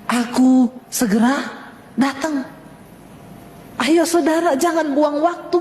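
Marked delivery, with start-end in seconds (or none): 0.1-2.5 slow, spaced-out divine proclamation; weighty pauses between the words; voice of God. Retold or imitated. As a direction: deliver it as a solemn message, word by word. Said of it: "Satu pesan Tuhan".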